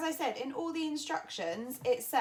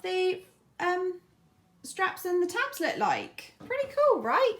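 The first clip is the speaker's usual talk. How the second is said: excited; 8 semitones higher, 4 dB louder than usual. Real, not acted. Showing off finished tabs.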